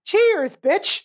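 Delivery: high, loud jab in a put-on British accent; mock British accent. Podcaster mocking an actress's accent, mock British. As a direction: mimic her slipping into a British accent. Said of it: "that's a British thing".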